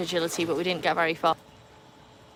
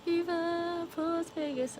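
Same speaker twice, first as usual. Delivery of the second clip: high, slow drawn-out catchphrase, softer; softer slogan voice; 10 semitones higher, 5 dB quieter, much slower than usual. Shown as a demonstration. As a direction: ease out the catchphrase gently. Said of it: "I just can't do it as good as you".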